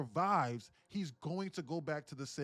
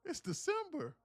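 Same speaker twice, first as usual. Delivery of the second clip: high, incredulous outburst; can't believe it's Easter talk now; real surprise; 12 semitones higher than usual. Real, not acted. Reacting to chat about Easter, high incredulous outburst.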